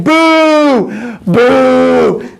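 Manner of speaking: long, high drawn-out boo; crowd booing. Retold or imitated. All the people rejecting Joshua, drawn-out booing. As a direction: jeer it like an unhappy crowd. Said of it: "all the people started saying".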